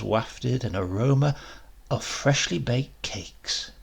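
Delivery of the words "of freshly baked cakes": ordinary delivery, nothing marked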